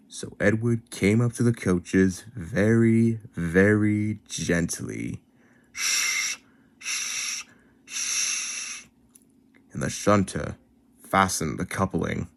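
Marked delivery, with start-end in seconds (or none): 5.7-8.9 soft unvoiced steam hiss. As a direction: hiss like a steam engine letting off steam. Steam sounds.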